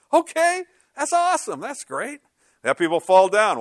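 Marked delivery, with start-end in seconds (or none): 0.1-2.2 mock giddy voice. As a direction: imitate the laughers happily agreeing in a squeaky voice. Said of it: "we had people start laughing".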